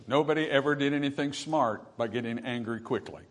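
ordinary delivery

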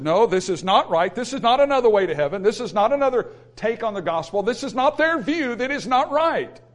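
emphatic preacher's refusal; forceful preaching. Acted. Preaching Paul's dissent at full force.

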